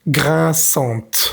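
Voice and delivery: "Grinçante" with the final T clearly sounded; teacher modelling the feminine T in "Grinçante"; pronouncing the T. Shown as a demonstration. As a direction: say "Grinçante" ending on a crisp T. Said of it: "tenemos que pronunciar la T".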